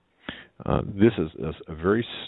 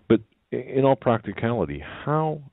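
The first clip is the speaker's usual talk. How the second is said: voice cracking; 7 semitones higher than usual. Real, not acted. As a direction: voice breaking upward. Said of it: "Sorry, my voice is cracking".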